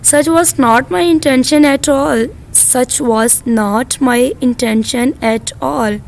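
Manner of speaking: each word stretched for students; slow classroom English; very slow model reading. Shown as a demonstration. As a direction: draw the sentence out carefully. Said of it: "मेरा ऐसा इरादा बिल्कुल भी नहीं था।".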